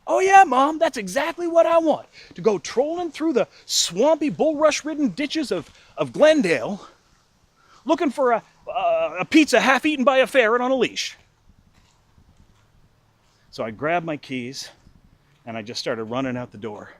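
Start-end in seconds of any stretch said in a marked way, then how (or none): 0.1-11.2 high, loud sarcastic rant; acted outraged scream, dripping sarcasm; screaming at mom. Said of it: "I screamed at my mom".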